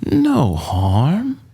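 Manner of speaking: put-on high, purring old lady; sweet and sly, hiding menace; sly old woman. A narrator's reading. Old woman purring to reassure Annabeth. Auntie Em soothing the kids.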